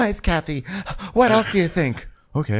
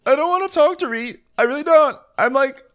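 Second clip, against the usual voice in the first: high, loud, upset whine; whiny Kyle impression; 4 dB louder than usual. Kyle refusing to talk to Dorit, high whine. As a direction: whine like you're fed up. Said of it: "She's like".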